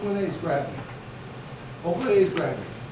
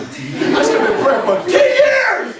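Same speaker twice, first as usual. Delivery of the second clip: high, loud and slow, through laughter; laughing exclamation; 9 semitones higher, 9 dB louder, slower than usual. Real, not acted. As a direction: laugh out the punchline. Punchline of the story.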